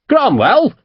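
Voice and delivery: sharp high angry call of the name. A narrator's reading. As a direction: snap the name out in anger. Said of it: "Fairley turned angrily on the captain".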